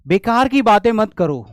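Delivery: loud stern scold, don't talk nonsense. Shown as a demonstration. Teacher demonstrating a scolding, loud and stern. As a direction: snap it at someone wasting time. Scolding example sentence in lesson.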